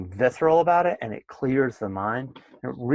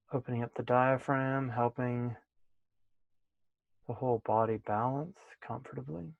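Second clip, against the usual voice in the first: softer, unhurried guiding voice, settling the class in; guided meditation; 3 semitones higher, 6 dB quieter than usual. Acted. Teacher settling class into meditation posture, soft guided voice. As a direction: ease them into posture, gently and softer.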